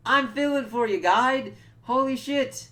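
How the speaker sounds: high, loud gushing reaction; over-the-top sympathy. Retold or imitated. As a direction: gush it like a moved fan.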